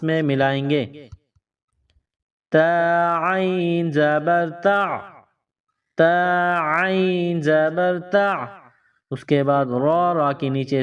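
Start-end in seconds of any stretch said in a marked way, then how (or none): 2.5-8.3 تا عین زبر تا drawn out, عین pressed from the throat; slow throaty ain. Shown as a demonstration. Teacher showing pupils the throat letter. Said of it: "گلے کے درمیان سے زور لگا کر".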